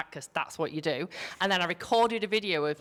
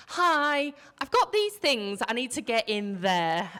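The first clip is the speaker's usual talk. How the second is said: high, louder begging tone; pleading; 6 semitones higher, 4 dB louder than usual. Retold or imitated. Emily pleading with the chap over the fence, high and louder. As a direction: plead through the fence to be let in. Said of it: "like, pleading".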